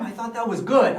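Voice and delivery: usual speaking voice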